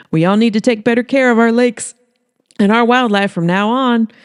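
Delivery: public-service message to the camera; Holly preaching. Acted.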